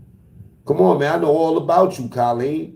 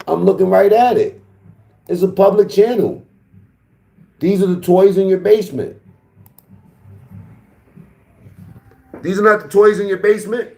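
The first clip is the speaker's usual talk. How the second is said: loud, high, heated insistence; shouting him down with the evidence; loud retort; 8 semitones higher, 5 dB louder than usual. Real, not acted. Arguing loudly with Colleen.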